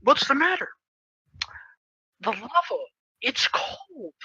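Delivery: soft, halting inner voice; voice in head. Retold or imitated. Holding his breath under lava, hushed inner voice. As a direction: speak softly with long gaps, as a thought. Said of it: "we hear in his head".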